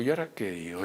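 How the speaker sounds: casi en voz baja, mucho más grave y suave; para sí mismo. Retold or imitated. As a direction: murmurar con apuro para uno mismo. Pensamiento nervioso ante San Pedro. (ante San Pedro, en voz baja, para sí mismo)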